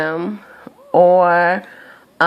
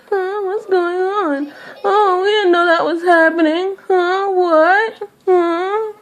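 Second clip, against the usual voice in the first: much higher, slow, fake-clueless whimper; mock innocent whine; 12 semitones higher, slower than usual. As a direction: play dumb in a squeaky put-on voice. Mocking people feigning ignorance.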